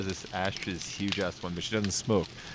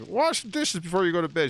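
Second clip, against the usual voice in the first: dad ordering; 8 semitones higher than usual. Retold or imitated. Dad telling daughter to do the dishes, bossy. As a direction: bark the order like a fed-up father.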